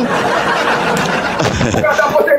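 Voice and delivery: high laugh at his own story; burst of laughter. Real, not acted. Man laughing at his own bank story, real laugh. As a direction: let the laugh break out.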